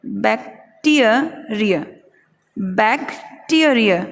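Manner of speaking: Bacteria very slowly as "bak... TEE-uh... ree-uh"; slow stressed syllable demo. Shown as a demonstration. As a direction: sound out bacteria in pieces, pushing TEE. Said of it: "ट पे ज़्यादा जोर".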